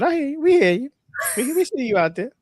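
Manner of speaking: high, loud singsong acknowledgement. Real, not acted. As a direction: call out high to someone across the room. Answering someone off to the side.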